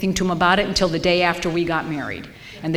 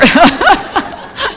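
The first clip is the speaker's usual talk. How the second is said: short high laugh rising at the end; a quick high giggle; 12 semitones higher, 10 dB louder than usual. Real, not acted. Still laughing at the story.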